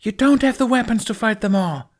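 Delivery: high, loud shout across the water; shouted warning. Acted. A warning shouted at the approaching fleet, high and loud. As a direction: yell the warning over the battle.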